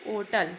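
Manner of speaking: ओटल starts on the vowel, the h silent. Shown as a demonstration. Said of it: "silent है". (ओटल, h silent)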